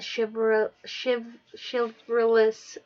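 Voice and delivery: drawn out slowly, struggling over chivalrous; slowed stumble. Real, not acted. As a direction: sound out chivalrous carefully. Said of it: "I can't even say the word".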